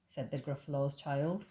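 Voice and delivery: ordinary delivery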